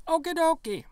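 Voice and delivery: high and loud, cartoonish chirp; squeaky okie dokie. Real, not acted. Shrugging off the riddle with a squeaky, loud chirp.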